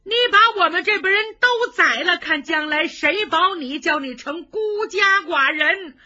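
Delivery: high, loud reproach hurled at the emperor; old lady's fury, shouted; bitter outburst. Acted. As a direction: scold the emperor, voice climbing. Old lady rebukes the emperor.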